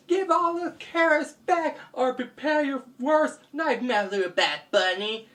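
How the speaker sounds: high ultimatum, easing down at the name; stern hero's threat. Acted. Hero catches thief, high-voiced ultimatum.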